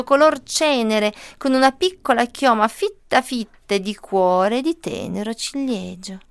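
usual speaking voice

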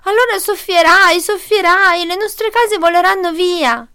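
high, loud wail of alarm; panicked piglets. A narrator's reading. Piglets fear for their houses, high panicked cry.

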